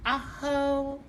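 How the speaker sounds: stretched out, drawn-out reveal; slow showcase reveal. Acted. Stylist presenting Miss Tanisha's new look, slow drawn-out reveal. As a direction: unveil the look like a grand prize.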